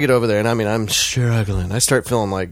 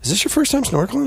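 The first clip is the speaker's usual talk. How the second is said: mock Megan question; 12 semitones higher, faster than usual. Imitating Megan.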